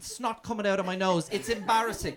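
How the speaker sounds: ordinary talk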